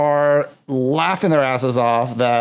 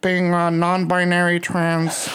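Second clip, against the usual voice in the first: airy, higher clueless host; clueless interviewer voice; 4 semitones higher, slower than usual. Retold or imitated. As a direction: play the unprepared talk show host. Mocking clueless interviewer questions.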